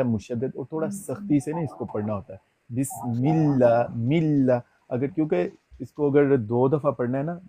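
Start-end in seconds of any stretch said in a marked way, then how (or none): 2.7-3.8 بسم اللہ with the doubled لام held firmly; doubled lam. Shown as a demonstration. Teacher shows how the shadda is read. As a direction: press the shadda in اللہ, slowly. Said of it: "شد والے حرف کو دو دفعہ پڑھا جاتا ہے".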